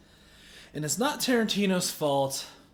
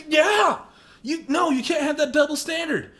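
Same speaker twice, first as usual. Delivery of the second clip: high, loud yeah; emphatic yell; 9 semitones higher, 5 dB louder than usual. Real, not acted. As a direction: blurt it out. Emphatic outburst.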